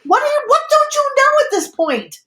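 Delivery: high-pitched furious disbelief; exasperated outburst. Real, not acted. Host challenging the caller's excuse, exasperated. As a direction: pitch way up, demand an answer.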